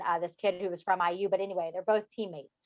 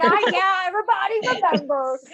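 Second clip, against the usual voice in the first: high, loud mock exclamation; exclaimed retold reply; 10 semitones higher, 5 dB louder than usual. Friend recalling the famous takedown, high exclaimed reply. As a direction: call it out high over the laughter.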